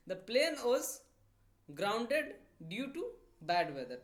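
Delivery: stretched out, firm start; slow dictation read. Shown as a demonstration. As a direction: dictate it slowly. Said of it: "ख़राब weather होने की वजह से".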